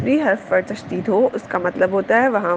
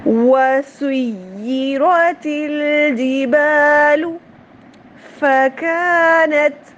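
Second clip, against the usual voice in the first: slow, drawn-out chanted recitation, pitched higher; Quran recitation; 5 semitones higher, 3 dB louder, much slower than usual. Shown as a demonstration. Teacher opening the lesson with slow chanted recitation. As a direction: recite each word slowly in melodic tajweed.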